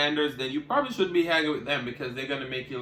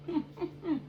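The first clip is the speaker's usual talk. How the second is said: high laugh; 7 dB quieter than usual. Real, not acted. Laughs after retort.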